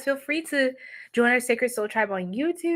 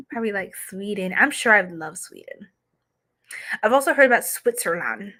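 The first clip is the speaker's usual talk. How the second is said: ordinary talk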